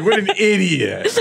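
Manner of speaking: mock scornful voice. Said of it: "We're like".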